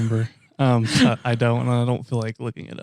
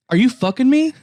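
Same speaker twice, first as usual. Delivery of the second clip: mock rant; 7 semitones higher, 3 dB louder than usual. Ranting at pushy strangers.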